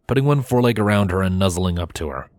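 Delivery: plain reading voice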